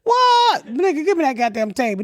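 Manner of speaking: a hammed-up shocked yell; mock outraged shout. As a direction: bark it like you can't believe the price. Acting out grabbing the table.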